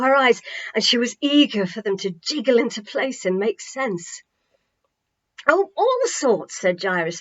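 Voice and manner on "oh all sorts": high, slow, sweeping "oh"; expansive. A narrator's reading. Jairus answering Tammy with a sweeping gesture, expansive. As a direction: throw it wide open. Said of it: "flinging his arms out".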